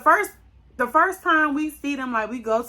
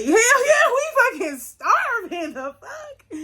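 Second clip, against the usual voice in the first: much higher and loud, laughing; eager loud agreement; 12 semitones higher, 5 dB louder, slower than usual. Retold or imitated. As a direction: friend yelling she's starving. Friend answering about food.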